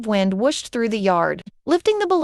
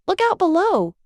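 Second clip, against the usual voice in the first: mild shout; 7 semitones higher than usual. A narrator's reading. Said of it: "Goofy shouted".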